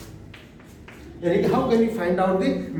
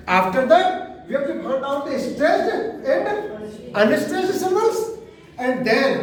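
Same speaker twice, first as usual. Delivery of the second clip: slow dictation; 2 semitones higher, much slower than usual. Shown as a demonstration. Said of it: "teacher dictates slowly".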